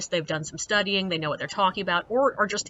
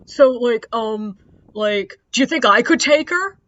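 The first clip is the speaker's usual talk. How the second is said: lightly voiced cocky guy, louder and higher; mocked cocky guy; 4 semitones higher, 8 dB louder than usual. Male guest asking the guide about a killer, mock cocky. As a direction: imitate a guy puffing himself up as he asks.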